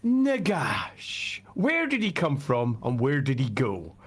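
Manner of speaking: ordinary delivery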